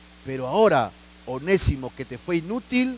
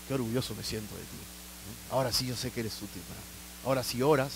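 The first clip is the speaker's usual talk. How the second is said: tender fatherly voice; 5 semitones lower, 7 dB quieter than usual. Acted. God speaks tenderly to listener.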